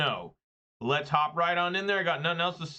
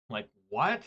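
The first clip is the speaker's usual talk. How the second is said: ordinary talk, nothing marked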